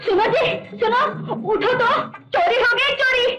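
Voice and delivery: high, frantic panic near tears; panicked wife. Acted. Wife finds the room ransacked, frantic and high. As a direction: she has just found the room ransacked and is panicking.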